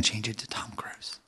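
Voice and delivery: hushed whisper aside. Real, not acted. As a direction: whisper it so the guest won't hear. Whispered aside about replacement.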